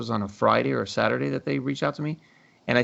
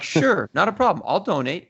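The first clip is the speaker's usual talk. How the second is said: fake eager agreement; 6 semitones higher, 4 dB louder than usual. Playing along with the scammer.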